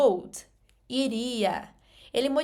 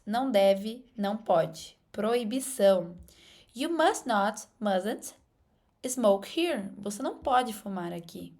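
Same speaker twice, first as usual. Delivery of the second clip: ordinary talk, nothing marked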